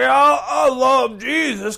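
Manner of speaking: a churchgoer mimicking a drunk who claims Jesus; mock drunk believer. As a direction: ham up the drunk outside the club.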